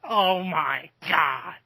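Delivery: slow, drawn-out exclamation; Joey Styles impression. Quoting Marco's Joey Styles impression, slow mock astonishment. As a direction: lightly imitate a stunned announcer, stretching each word. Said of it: "do a good Joey Styles impression".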